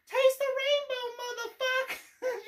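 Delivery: imitating the unicorn girl's high yell; little girl yelling. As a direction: belt it like a tiny girl screaming. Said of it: "this little girl just yells".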